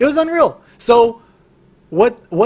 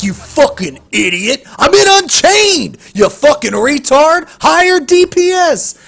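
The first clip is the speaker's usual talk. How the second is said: louder, much higher mocking rant; imitating an arrogant teammate's put-down; degrading sneer; 7 semitones higher, 6 dB louder than usual. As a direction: belittle him like a smug know-it-all. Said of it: "just so degrading".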